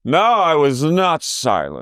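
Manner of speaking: slow, high parody of a protest; imitating Meredith. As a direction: draw out the denial. Mimicking Meredith.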